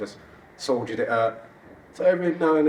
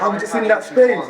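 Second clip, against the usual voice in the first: high, loud, cracking up; real laughing; 11 semitones higher, 6 dB louder than usual. Real, not acted. Man explaining his crying moment to the group, laughing. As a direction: push the words out through laughter. Helpless laughter while telling story.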